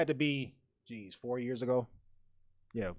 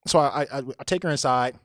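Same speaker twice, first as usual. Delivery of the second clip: ordinary talk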